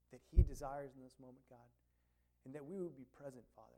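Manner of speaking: soft, hushed pastoral prayer. Real, not acted. Pastor prays over the congregation, soft and hushed. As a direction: pray quietly for the people to be present.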